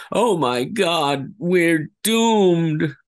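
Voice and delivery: a scientist who knows it's over; doomed scientist's despair. Shown as a demonstration. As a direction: stretch out "doomed" in hopeless dread. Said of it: "Like so".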